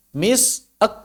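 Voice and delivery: Mis- then ek pulled apart; split slow demo. Shown as a demonstration. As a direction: break misk into two slow pieces. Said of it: "Kalau lambat". (dipisah pelan)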